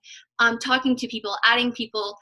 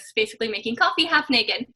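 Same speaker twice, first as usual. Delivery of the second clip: ordinary talk, nothing marked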